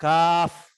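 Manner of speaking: Kaf punched loud and high; stressed Kaf. Shown as a demonstration. Teacher spelling Kaf out loud. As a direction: hit Kaf hard. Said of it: "Ada huruf ka, huruf alif sama huruf fa".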